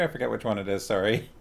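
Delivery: ordinary delivery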